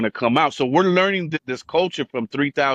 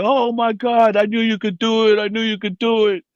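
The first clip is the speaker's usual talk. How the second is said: high, gushing mock praise; overjoyed dad; 9 semitones higher than usual. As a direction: imitate a father suddenly thrilled with his son. Acts father's sudden proud reaction.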